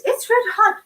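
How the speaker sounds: hammed-up cry of pain; patient complaining. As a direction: squeal it like a scalded patient. Patient voiced in a rhyme.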